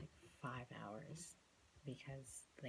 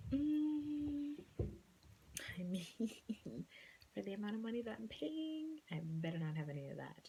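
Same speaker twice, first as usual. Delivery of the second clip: higher, louder sassy aside; mock sassy self; 8 semitones higher, 5 dB louder than usual. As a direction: play your own diva side. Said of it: "So I was kinda just like".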